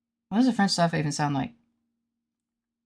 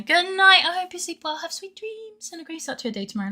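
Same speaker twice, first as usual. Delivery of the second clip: very high sing-out, softening away; hammed-up sweet good night; 13 semitones higher than usual. Wishing the audience sweet dreams, high mock-sweet voice. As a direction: coo a mock bedtime farewell up high. Sugary acted bedtime farewell to viewers.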